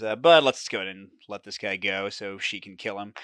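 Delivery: ordinary talk, nothing marked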